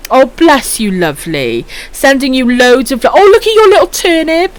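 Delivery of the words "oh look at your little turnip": cooing. Real, not acted. Cooing over a viewer's emote.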